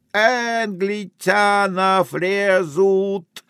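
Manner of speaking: high, very slow lisping urchin call; boy's shout. Acted. Greek boy dancing on the wall, high drawn-out shout. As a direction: chant it out high and long, lisping. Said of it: "кричит".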